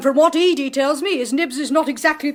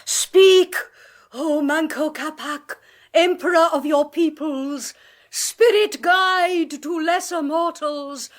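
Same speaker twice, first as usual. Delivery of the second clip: slow, intoning call to the spirit; séance invocation; 3 semitones higher, slower than usual. Acted. Medium intoning an invocation to the emperor's spirit, slow ritual. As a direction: draw the spirit out with a ritual chant.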